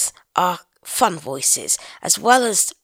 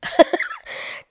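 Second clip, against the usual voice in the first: high, breathy burst of laughter; real giggle; amused laugh; 3 dB louder than usual. Real, not acted. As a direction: laugh at a silly cartoon voice. Reacts to the cartoon voice clip.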